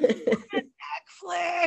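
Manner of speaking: slow, high mimicry; Molly. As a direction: imitate a daughter's stretched-out request. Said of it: "in her Molly voice".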